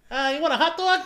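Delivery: high, laughing mock American accent; Eddie's American accent. Teasing a friend's fake American accent, high and laughing. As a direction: ham up a dumb American accent. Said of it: "what's your American accent".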